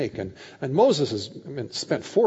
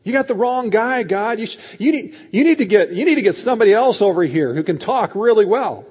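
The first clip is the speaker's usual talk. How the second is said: loud, high, stumbling objection; acted exasperated refusal; agitated protest; 8 semitones higher, 9 dB louder than usual. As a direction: push back hard, tripping over restarts. Acted Moses refusing God's call.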